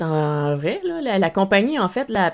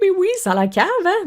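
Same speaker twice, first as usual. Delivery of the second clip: 1 cutesy voice; 12 semitones higher, 4 dB louder than usual. Pretend. Cutesy voice to plush.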